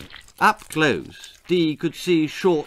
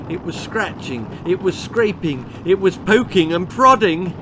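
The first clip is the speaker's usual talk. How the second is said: growing louder and higher each phrase; building tension; 4 semitones higher, 5 dB louder than usual. A narrator's reading. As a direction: build up to prodding.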